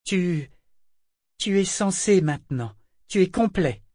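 high-pitched, halting wonder; awed admiration. A narrator's reading. Man marvels that the ship is complete, awed exclamation. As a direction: marvel aloud, high and emphatic.

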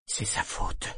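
unvoiced, growled whisper; low growl. A narrator's reading. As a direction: mutter the blame under your breath. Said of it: "gronda tout bas Eta".

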